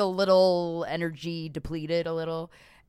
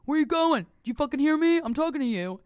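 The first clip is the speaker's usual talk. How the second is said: high, loud imitated demand; aggressive catcaller; 8 semitones higher, 5 dB louder than usual. Catcaller to a woman ignoring him, imitated angry hounding. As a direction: badger someone who is ignoring you, voice raised.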